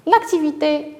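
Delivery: ordinary talk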